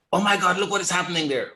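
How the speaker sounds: high, mock-panicked gasp at the chart; panicked retail trader. Teacher mimics a retail trader freaking out at a spike, mock panic. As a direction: play the scared trader seeing a spike. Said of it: "This is what scared the hell out of you".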